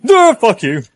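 high, loud panicked yell; terrified scream. Real, not acted. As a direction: shriek it at the jump scare. Jump scare hits.

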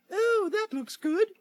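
put-on high, slow girlish coo; put-on high, tempted voice; Goldilocks. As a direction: coo greedily at the food. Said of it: "and she's like".

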